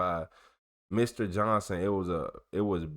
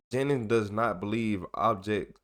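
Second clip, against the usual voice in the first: ordinary talk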